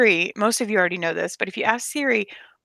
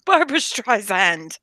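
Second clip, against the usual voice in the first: imitated Irish Siri; slower than usual. Echoing Irish Siri's pronunciation.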